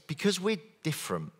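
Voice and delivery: ordinary delivery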